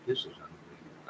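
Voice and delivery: soft whispered disbelief; hushed aside to himself; whispered exasperation. Real, not acted. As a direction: breathe it out in exasperation. Exasperated aside while reading chat.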